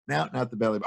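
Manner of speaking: ordinary delivery, nothing marked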